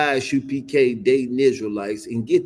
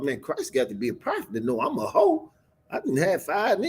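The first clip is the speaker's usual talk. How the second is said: voiced woman's thoughts; 7 semitones higher, 3 dB quieter than usual. Woman's inner thought voiced high.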